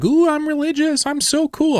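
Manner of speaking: high, smug mock voice of a preachy guy; sneering falsetto parody of a religious snob; mocking pious guy. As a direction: squeal it like a self-satisfied zealot. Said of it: "There was this guy like".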